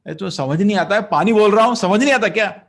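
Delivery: high, loud furious Hindi rebuke; angry shout. Retold or imitated. King yelling at the silent sage for water. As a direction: yell at him for ignoring you.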